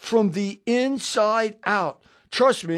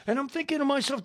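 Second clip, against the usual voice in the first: ordinary talk, nothing marked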